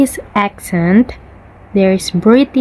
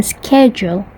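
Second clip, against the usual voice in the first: American "schedule" with a hard "sk", falling; American k-sound "schedule"; American accent. Shown as a demonstration. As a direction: say "schedule" the American way, "sked-jool".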